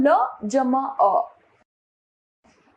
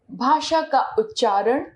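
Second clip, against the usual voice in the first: ordinary talk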